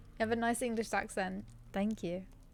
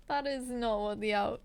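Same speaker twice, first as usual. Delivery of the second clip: usual speaking voice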